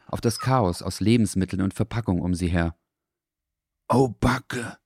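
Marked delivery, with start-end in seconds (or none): 3.9-4.8 higher, worried little dog. A narrator's reading. Leo realises the trouble, worried and high. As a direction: Leo suddenly sees the mess. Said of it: "flüsterte Leo plötzlich".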